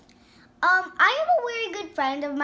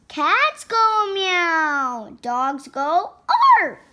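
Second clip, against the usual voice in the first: very slow, stretched-out animal sounds; mother correcting; 3 dB louder, slower than usual. Acted. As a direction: teach the pup, dragging each sound. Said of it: "said George's mother".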